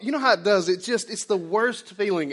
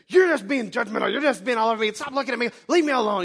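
pretend wife snapping; 5 semitones higher, 4 dB louder than usual. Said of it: "looked at me and said".

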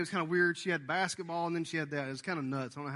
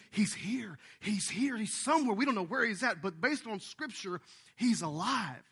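rising, louder, eager news that he is here; excited announcement; 5 semitones higher, 4 dB louder than usual. Retold or imitated. Preacher retelling the news to the crowd, excited. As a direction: tell them breathlessly he's here.

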